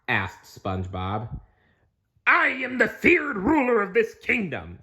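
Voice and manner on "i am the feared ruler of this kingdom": higher, grand ruler voice; King Krabs. Acted. King Krabs announcing himself to prisoners, grand boast.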